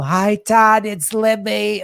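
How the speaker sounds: high, sweet Libby; Libby character voice. Retold or imitated. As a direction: chirp it out as Libby calling in. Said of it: "call in with these characters, Libby and Lionel".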